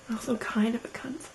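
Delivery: quick, barely voiced whisper; whispered aside. Real, not acted. Adding a teasing insult about her friend, whispered aside. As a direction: slip it in quickly under your breath.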